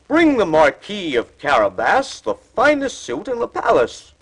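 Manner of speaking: high, loud royal command; king voice. A narrator's reading. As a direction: order it like a king. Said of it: "the king said".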